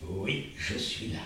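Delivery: low, soft and slow, from far away; soft inner voice. Acted. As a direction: answer gently from deep inside. Wolf's voice from inside.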